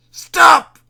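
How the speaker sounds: high, loud anguished cry; shout. A narrator's reading. Mayor screaming at the hiss in his ears, loud shout. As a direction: scream it out in pain. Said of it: "he shouted".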